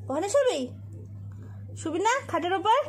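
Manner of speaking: high, loud pet talk; talking to the dog. Real, not acted. Girl coaxing her dog onto the bed, high loud pet talk.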